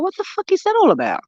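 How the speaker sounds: high, fast, outraged squeal. Retold or imitated. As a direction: blurt it out in disbelief. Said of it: "I was like".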